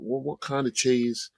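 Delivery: normal talking voice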